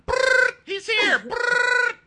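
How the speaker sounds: very high, loud trilled alarm; hammed-up panicked whistle-blowing; shrieked whistle imitation. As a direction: trill like a whistle, squeal the warning between blasts.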